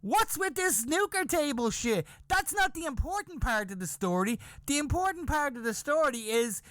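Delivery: high-pitched, emphatic incredulous rant; exasperated disbelief. Acted. Teenager protests to the women's reaction, exasperated disbelief. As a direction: argue that everyone has missed the point. Young comedian protests their priorities.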